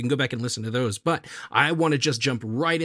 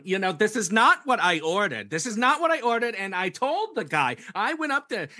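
lightly hammed-up New York accent, pitched up; 7 semitones higher than usual. Woman complaining about her bagel order, mock-indignant New York accent. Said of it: "That's a great accent too".

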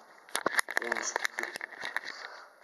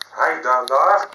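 shouting out, much louder, rising on the last word; 11 dB louder than usual. Real, not acted. Man repeats his call to someone, shouting louder. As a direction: bellow it out the second time.

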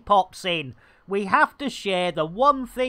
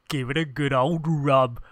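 lightly put-on accent, said lower; Tony's accent; 5 semitones lower than usual. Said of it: "as Tony would say".

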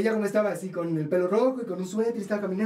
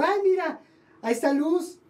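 much higher, delighted spotting of someone; excited calling out; 10 semitones higher than usual. Retold or imitated. Storyteller spots an actress at a premiere, excited calling out. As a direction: call it out high, as if recognizing an acquaintance.

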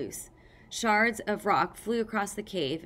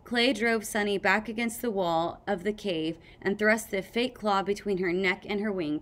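ordinary delivery, nothing marked

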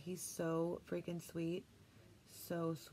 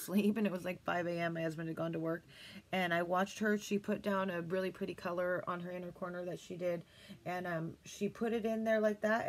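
ordinary talk, nothing marked

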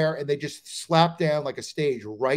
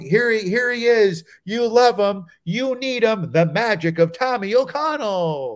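louder, higher showbiz announcer; stage introduction; 5 semitones higher, 3 dB louder than usual. Acted. Announcer introducing the headliner to a hostile crowd, showy hype. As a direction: build him up like a magic act. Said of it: "they're introducing him".

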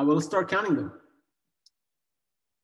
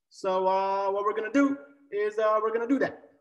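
higher-pitched mock presidential speech with long pauses; hammed-up impression of a president's measured cadence; Obama accent; 6 semitones higher than usual. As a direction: play the teacher as a US president addressing the nation. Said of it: "I got my Obama accent".

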